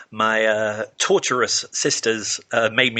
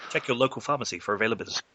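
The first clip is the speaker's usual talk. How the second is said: fast disclaimer; 6 dB quieter, faster than usual. Acted. Ad disclaimer rattled off.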